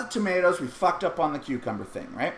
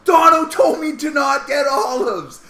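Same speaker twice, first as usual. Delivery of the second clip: higher, loud shouted excuse; blaming shout; 8 semitones higher, 8 dB louder than usual. Retold or imitated. Dad shifts the blame to his friend: higher shouted excuse. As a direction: yell the blame onto Dono.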